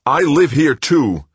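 high, loud and slow, pleading; desperate plea. Acted. Son to mother, high desperate plea. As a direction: beg not to be thrown out.

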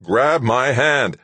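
insist, stretching each word; urgent plea to a man in danger; insistent. A narrator's reading. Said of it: "Doug insisted".

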